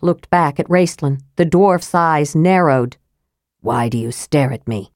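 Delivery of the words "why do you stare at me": a quiet menace from the mage; demanding mage. A narrator's reading. Raistlin challenging the staring dwarf, low and cold. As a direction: lower and softer, a cold challenge. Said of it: "the mage demanded".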